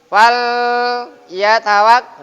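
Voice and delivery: Fal yatawak punched loud on the lam; wrong pressed lam. Shown as a demonstration. Teacher showing students the forbidden pressed reading. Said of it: "tidak boleh".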